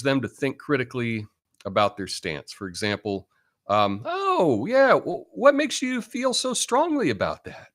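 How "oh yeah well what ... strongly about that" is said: high, curious, friendly questioning voice; acting out a gentle question. Shown as a demonstration. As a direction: sound open and curious, not judging. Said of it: "Was that good acting?".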